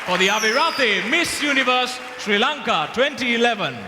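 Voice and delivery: rising announcer voice; pageant host. Real, not acted.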